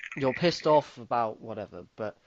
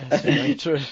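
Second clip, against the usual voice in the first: laughing; 4 semitones higher, 7 dB louder than usual. Real, not acted. Laughing after a joke.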